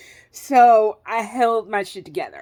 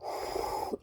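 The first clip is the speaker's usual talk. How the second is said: long, soft breathy sigh; heavy exhale; 15 dB quieter than usual. Real, not acted. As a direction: let out a slow sigh before going on. Pausing before describing the pain.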